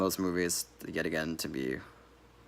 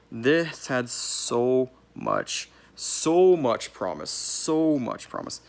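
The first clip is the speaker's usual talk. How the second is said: slow, higher and louder on every word; drawn-out lament; 5 semitones higher, 5 dB louder, much slower than usual. Real, not acted. Reviewer lamenting a wasted sequel, slow and emphatic. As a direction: stretch each word out in dismay.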